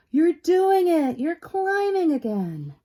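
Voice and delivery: high, loud, slow cheer; excited ladybug cheer. Acted. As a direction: cheer her on with delight. Polly cheers.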